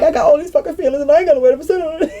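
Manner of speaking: high, quick whine tipping into laughter; mock crying. Retelling crying over her feelings, mock tearful whine. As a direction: wail it like a hammed-up crybaby, nearly laughing. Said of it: "now I'm in here crying 'cause I'm like".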